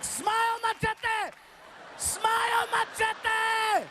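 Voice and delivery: high, strained mock yell; cackle-pitched call, held high on one note; losing it. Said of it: "I lost it. I was like".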